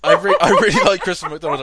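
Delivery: loud laughing protest; laughing loudly. Real, not acted. Defending his pick, laughing loudly.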